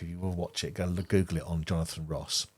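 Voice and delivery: usual speaking voice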